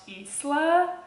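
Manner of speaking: "Isla" as EES-la, with the s sounded; book's pronunciation. Shown as a demonstration. Reader showing how the book says a name, "Isla" as EES-la. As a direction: say "Isla" with an ee start and a hissed s. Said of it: "it's supposed to be pronounced".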